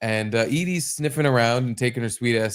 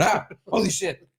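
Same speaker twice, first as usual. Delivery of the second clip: mock startled yelp; 7 semitones higher than usual. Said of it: "I'm gonna be going".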